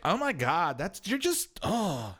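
high mock exasperation; acted huffy disbelief; exasperated date. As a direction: imitate an offended date, pitch climbing. Date's exasperated reply in retold quarrel.

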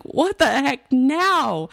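high, drawn-out mock exasperation; retold exasperation. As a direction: stretch it out like a fed-up parent. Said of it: "it was so frustrating and infuriating".

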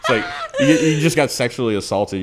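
high laughter breaking through the words; cracking up at a friend's bar story; laughing. Real, not acted.

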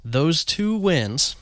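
slow, drawn-out stalling; stretched-out words. Real, not acted. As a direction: stretch each word to buy time. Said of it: "as I stretch out my words".

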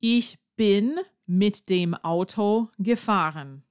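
"Ich bin mit dem Auto gefahren" said slowly and clearly, word by word; teacher models the sentence slowly for learners. Shown as a demonstration. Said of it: "you have to say".

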